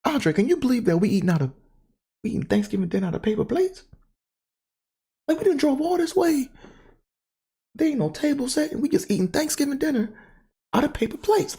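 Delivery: high, fast indignant mother voice; imitating mad mom. Mom ranting to her son about paper plates, high and fast. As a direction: play the fuming mom, high and quick. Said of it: "She was like".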